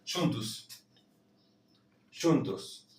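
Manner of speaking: Juntos with a soft J and a final U; Portuguese juntos. Shown as a demonstration. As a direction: say Juntos as shuntus. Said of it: "La J se va a pronunciar como una Y".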